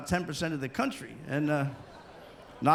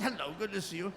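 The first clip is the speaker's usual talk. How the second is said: put-on English accent; 7 dB quieter than usual. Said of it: "You're always".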